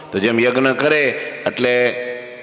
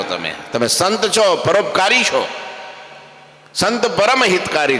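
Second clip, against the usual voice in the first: pleading Bhakti; 6 semitones higher than usual. Acted.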